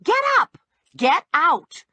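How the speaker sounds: sharp high yell to get him up. Acted. Narrator yelling at Bunchy asleep on her couch, loud. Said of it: "I yelled at him".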